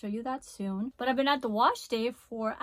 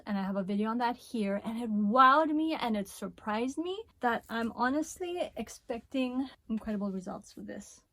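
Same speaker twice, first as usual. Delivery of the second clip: ordinary delivery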